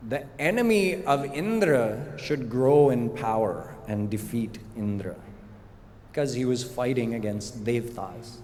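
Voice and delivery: ordinary talk, nothing marked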